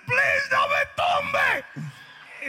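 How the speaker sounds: very high, slow, squealing plea; hammed-up terrified begging; retold panicked plea. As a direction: rider begging the horse not to throw him, squealing.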